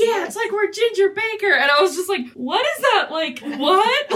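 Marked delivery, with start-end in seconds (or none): 0.0-1.5 much higher, laughing through the line; laughing mock quote. Friend laughing while retelling the scene, high laughing voice. Retold Hoffman repeats with laugh. 2.3-4.1 much higher, baffled and amused; mock disbelief. Telling friends how she reacted, high amused disbelief. Own reaction quoted, laughing.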